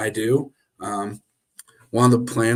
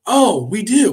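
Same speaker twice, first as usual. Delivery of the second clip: mock surprise; 10 semitones higher, 8 dB louder, slower than usual. Sarcastic fake realisation.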